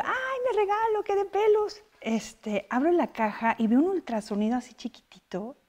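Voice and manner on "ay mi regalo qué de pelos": much higher, thin put-on voice; mock delighted squeal. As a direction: gush over the gift in a squeaky voice. Retells her reaction to gift.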